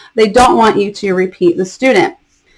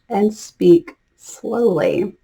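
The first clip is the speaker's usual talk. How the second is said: drawn out, much slower; slow speech demo; 4 dB quieter, much slower than usual. Shown as a demonstration. Teacher showing ESL teachers slow classroom speech. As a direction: stretch each word like teaching a beginner. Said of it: "want you to slow down in your speech".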